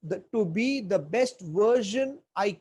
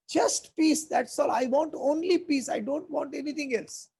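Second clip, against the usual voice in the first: higher-pitched mock plea for peace; pleading film Hitler; 7 semitones higher than usual. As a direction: imitate an actor dressed as Hitler, pitched up, protesting innocence. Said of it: "he looks at all those people and says".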